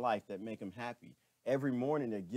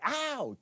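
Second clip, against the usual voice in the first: yelp of pain; 14 semitones higher than usual. Pretend. Mimicking daily pain.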